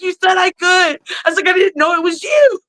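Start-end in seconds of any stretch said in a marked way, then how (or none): 0.0-1.0 put-on high, shouted kid protest; indignant kid. Mother and son arguing after match. 1.5-2.6 high, shouted mock exasperation; exasperated mom. Mother answering son after match.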